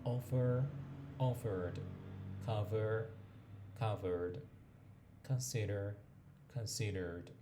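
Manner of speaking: American English, final r sounded in offer, cover, consider; American accent, rhotic endings; American pronunciation. Shown as a demonstration. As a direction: roll into a clear American r. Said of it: "vamos con el americano".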